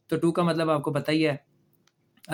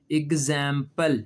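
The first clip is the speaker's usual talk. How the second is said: Example drawn out slowly, ig-zam-pel; slow model word; 3 semitones lower, much slower than usual. Shown as a demonstration. Teacher modelling the word slowly for children. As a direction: spell out example slowly for the class. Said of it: "देख लें आपके सामने है".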